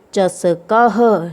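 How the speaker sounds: चसकह drawn out slowly, syllable by syllable; demonstrating pronunciation. Shown as a demonstration. Teacher shows children how चसकह is read, slow syllabic pronunciation. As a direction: stretch चसकह, sounding the final ह. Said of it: "इसको ऐसे पढ़ेंगे".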